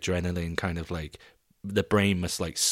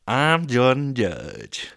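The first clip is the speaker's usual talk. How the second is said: very slow, loud, high proclamation; mock-grand, drawn-out self-introduction; hammed-up contestant voice; 5 semitones higher, 6 dB louder, much slower than usual. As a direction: announce yourself grandly, stretching every word. Hams up the contestant's name.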